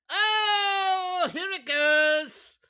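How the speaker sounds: high, hammed-up fake sobbing; mock crying. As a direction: wail it like a fake cry. Mock crying over her list.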